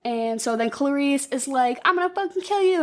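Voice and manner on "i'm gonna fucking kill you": higher, hammed-up angry vow; retold furious threat; mock threat. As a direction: pitch it up and spit it out. Clarice threatens the killer.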